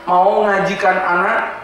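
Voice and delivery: ordinary talk, nothing marked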